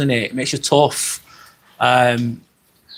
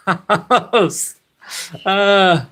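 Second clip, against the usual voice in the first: real laugh splitting the phrase; breaking into laughter; 6 semitones higher than usual. Real, not acted. Streamer reading a viewer's mum joke, breaking into laughter. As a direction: crack up before the last word.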